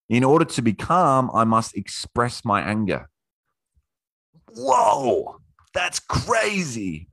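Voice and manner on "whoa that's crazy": mock amazement. Mock amazement at own point.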